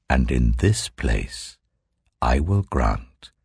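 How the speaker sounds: slowly, like God making a promise; solemn voice of the Lord. Acted. Voicing the Lord's promise.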